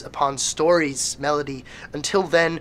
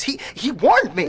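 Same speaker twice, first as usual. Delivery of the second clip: higher, rattled and stammering; frightened; 9 semitones higher than usual. Acted. Follower recoiling from the reporter, frightened. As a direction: back away in fear.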